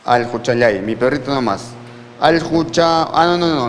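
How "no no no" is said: usual speaking voice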